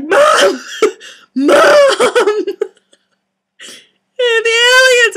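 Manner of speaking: very high, loud calls, laughing; excited yelling down stairs. Retold or imitated. Daughter shouting downstairs to mom about aliens, laughing. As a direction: holler down to mom. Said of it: "I was like".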